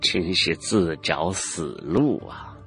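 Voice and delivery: cold chuckle, then slow, low scorn; slow, quiet derision after a dry laugh; cold sneer. A narrator's reading. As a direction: sneer softly at their folly. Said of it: "江姓老者冷笑一声".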